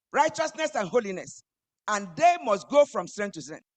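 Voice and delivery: loud, high, impassioned preaching; fiery preaching. Acted. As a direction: preach it out with full force. Preaching at full force.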